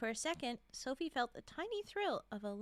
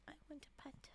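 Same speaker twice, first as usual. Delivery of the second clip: whispering; 17 dB quieter than usual. Real, not acted. Whispering between coughs.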